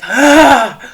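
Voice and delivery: loud short panicked scream; imitated loud shriek of alarm; mock scream. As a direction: yelp like something just blew up. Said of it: "You're just like".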